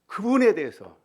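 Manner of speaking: loud, high stress on 그분에; 그분에 punched loud and high; preaching emphasis. Real, not acted. Preacher stresses repeated phrase. (그분에를 크고 높게 강조)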